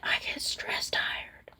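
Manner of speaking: soft breathy whisper. Real, not acted. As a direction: whisper it, tired and drained. Repeats tired phrase under breath.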